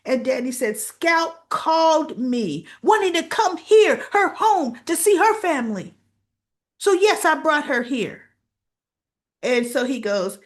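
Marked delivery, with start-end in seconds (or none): 0.8-8.2 higher, louder pleading; mock heated defense; upset defense. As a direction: press your case, rising. Danny defending himself.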